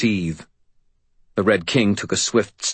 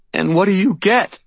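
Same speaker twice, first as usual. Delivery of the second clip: high, louder, trembling demand; voice shaking; 12 semitones higher, 5 dB louder than usual. A narrator's reading. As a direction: force the question out through anguish. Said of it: "voice shaking".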